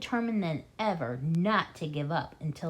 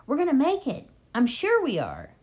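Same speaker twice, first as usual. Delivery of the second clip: hopeful; 7 semitones higher, 5 dB louder than usual. Acted. Mister Fox encouraging during race.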